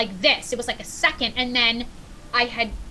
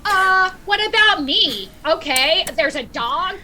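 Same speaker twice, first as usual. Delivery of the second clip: mock offended; 5 semitones higher, 4 dB louder than usual. To firemen who noticed the dog first, mock offended. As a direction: play up being ignored for the dog.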